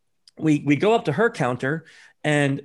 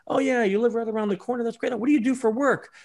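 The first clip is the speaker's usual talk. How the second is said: bright, chatty stranger making friends, pitched higher; friendly small talk; 7 semitones higher than usual. Shown as a demonstration. Con man acting friendly to targets, bright and chatty. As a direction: charm them with easy questions.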